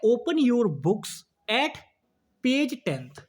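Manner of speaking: slow, word by word reading; slow dictation. Shown as a demonstration. Teacher reading the exam question to students, slow. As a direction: read the sentence out slowly. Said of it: "question पढ़िए, कह रहा है".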